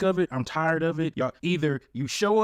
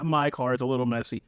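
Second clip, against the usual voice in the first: normal talking voice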